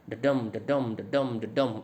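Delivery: "डडम" as da-DUM, the second syllable punched; iambic beat. Shown as a demonstration. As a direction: beat out "डडम" evenly, weak then strong. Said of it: "इस तरह की beat बनती है". ("डडम" में "डम" पर ज़ोर, ताल में)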